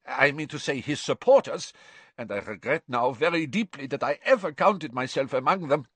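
frightened prisoner hurrying his excuses; hurried and nervous. A narrator's reading. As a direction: hurry to justify yourself. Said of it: "said Karkaroff hurriedly".

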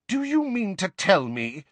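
high, building indignant voice; rising anger. A narrator's reading. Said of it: "her voice growing louder with every word".

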